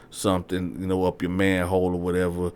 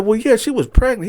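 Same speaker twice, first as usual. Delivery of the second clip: much higher, louder, flat-out; blunt girlfriend; 14 semitones higher, 7 dB louder than usual. Retold or imitated. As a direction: state it bluntly. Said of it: "She was like".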